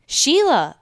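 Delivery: high, loud call of a name; screamed name. Retold or imitated. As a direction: imitate a mom yelling for her child. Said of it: "when my mom screamed my name".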